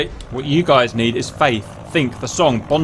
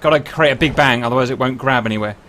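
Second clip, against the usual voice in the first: ordinary talk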